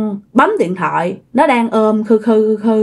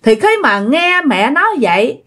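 usual speaking voice

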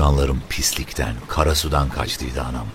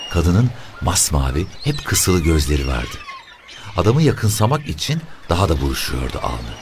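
ordinary delivery, nothing marked

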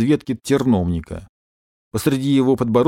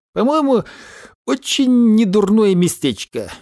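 porcupine's pompous voice; 9 semitones higher than usual. Acted. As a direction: hold forth like a smug porcupine. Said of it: "громко разглагольствовал он".

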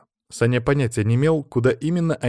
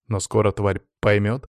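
usual speaking voice

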